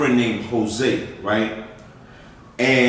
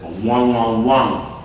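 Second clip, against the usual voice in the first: put-on Dominican accent; slower than usual. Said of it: "they had the heavy accent".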